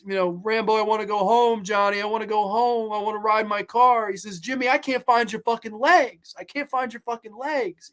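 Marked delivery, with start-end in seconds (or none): 0.4-4.1 higher, lightly tearful pleading; imitating crying. Fan voicing the dying friend, mildly tearful. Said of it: "And he's crying and he says". 4.4-7.9 higher, understated tearful despair; mock sobbing. Rambo crying over his friend, lightly tearful. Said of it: "He's crying".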